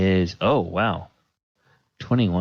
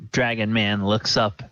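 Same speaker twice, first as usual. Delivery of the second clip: normal talking voice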